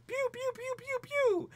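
squeaky laser gun sounds; high toy blaster noises; high-pitched pew pew. Pretend. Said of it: "So he can be like".

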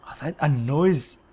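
ordinary talk, nothing marked

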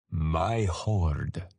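drawn-out, weary ancient voice; sighing old dragon. A narrator's reading. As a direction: explain as with a great sigh. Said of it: "explained with a great sigh".

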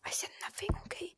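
whispering. Real, not acted. Secretly taking back a slip.

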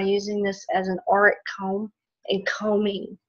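raspy whisper, voice giving out; hoarse voice fading to a whisper; losing voice. Real, not acted. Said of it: "I'm losing my voice a little bit".

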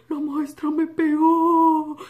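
put-on slow, whimpering child's complaint; tearful child. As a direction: say it like a crying little girl. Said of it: "Y yo le dije". (niña llorosa, lenta)

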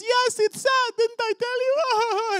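loud, very high mock wail; crying little boy. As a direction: bawl it like an inconsolable child. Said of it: "And they're both crying".

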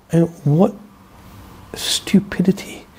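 hushed disbelief; whispered aside. Real, not acted. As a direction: whisper stupidity slowly. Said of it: "dismayed aside".

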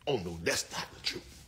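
ordinary talk, nothing marked

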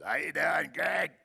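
put-on heavy-smoker old lady. Old lady at Stems and Bonnets greets the pastor, heavy-smoker voice. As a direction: holler it like a chain-smoking old woman. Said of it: "She smoked, like, fifty packs a day".

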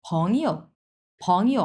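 "ফং ইয়ো" with "ইয়ো" touched and let go; second tone on "ফং", then a short toneless "ইয়ো"; neutral-tone ending. Shown as a demonstration. Teacher joins both syllables for learners, neutral-tone ending. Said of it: "আমি কিন্তু ইয়োটা ধরেই ছেড়ে দিচ্ছি".

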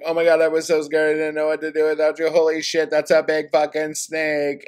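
high, loud, rushed panic babble; panicked shriek. Acted. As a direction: gush out terrified relief then alarm at the snake. Octavia panics, running in.

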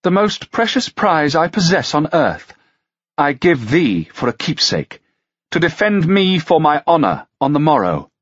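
stately lady; declaring. A narrator's reading. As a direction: bestow the prize publicly. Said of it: "she declared".

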